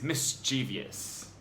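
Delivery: "mischievous" as mis-CHEE-vee-us, four syllables; "mischievous" said slowly, stressed on CHEE. Shown as a demonstration. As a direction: stretch out "mischievous" with an extra ee before -us. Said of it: "I've always pronounced this word as".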